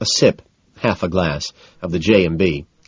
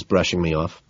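ordinary delivery, nothing marked

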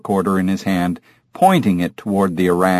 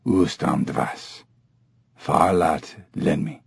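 low, slow ape grunting; soft, deep guttural orangutan speech; guttural grunts; 6 semitones lower, 6 dB quieter, slower than usual. A narrator's reading. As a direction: grunt the words low in the throat. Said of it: "a sequence of guttural grunts".

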